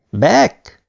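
high, mild shout of a name; calling out. A narrator's reading. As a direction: call out to wake a crewmate. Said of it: "kiáltott Martinez". (kiáltva szólítja)

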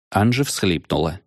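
ordinary delivery, nothing marked

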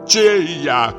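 high, anguished question; despairing cry. Acted. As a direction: wail it out, utterly forlorn. Lonely actor cries out in despair.

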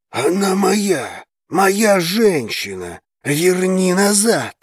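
slow, high furious yell. A narrator's reading. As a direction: roar it, losing control. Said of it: "заорал дракон".

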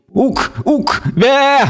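high shouted goat bleats; goat shouting. Acted. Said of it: "кричал козёл".

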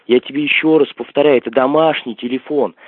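normal talking voice

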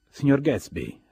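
loud, high, hearty greeting covering dislike; well-concealed displeasure. A narrator's reading. Tom offering Gatsby his hand, well-concealed displeasure. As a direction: greet loudly, hiding the displeasure. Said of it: "con un disappunto ben dissimulato".

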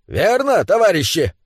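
high, eager call to comrades; rallying cry. Acted. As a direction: rouse the comrades to agree. Dwarf rallies his comrades. (задорно, призывая товарищей)

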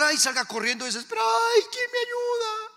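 high-pitched hammed-up wail for help; mock desperate cry. Preacher mocks believer who runs screaming for help, desperate wail. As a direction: imitate someone crying out in panic. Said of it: "No empiece a gritar".

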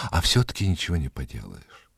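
ordinary delivery, nothing marked

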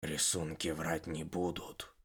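soft, low, distant tone; detached. Acted. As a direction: say it lost in thought. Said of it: "несколько отрешённо". (отрешённо)

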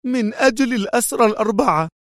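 high, fast and tense; nervous anger. A narrator's reading. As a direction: rush it out agitated. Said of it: "لتضيف في عصبية".

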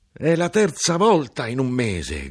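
high, loud, slowed outraged cry; shouting. A narrator's reading. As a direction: rage at the third fine this month. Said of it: "gridò". (grido alto e forte, lento e indignato)

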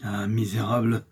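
rage contenue. A narrator's reading. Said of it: "comme une panthère blessée".

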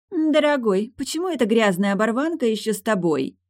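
high-pitched spoiled whine to a sweetheart; capricious pout. A narrator's reading. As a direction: pout and complain like a pampered girl. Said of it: "спросила девушка капризно и надула губы".

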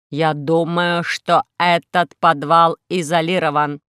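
slow, low, measured robot voice; solemn robot. Acted. Robot explains the dead signal to Alisa, slow and measured.

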